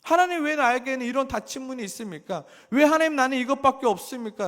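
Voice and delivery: high, loud protest to God; resentful complaint. Acted. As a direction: act out a believer crying out in grievance. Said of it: "라고 원망하죠".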